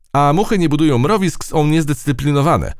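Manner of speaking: lightly voiced high, emphatic soldier; judging ant soldier. A narrator's reading. Ant soldier criticises flies, high and judging. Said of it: "oceniła wojowniczka".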